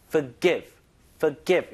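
Forgive said with the second syllable stressed, for-GIVE. Shown as a demonstration. Teacher modelling the word, stress on the end. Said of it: "이거 강세 반드시 뒤에 줘야 돼요".

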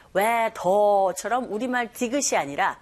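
ordinary delivery

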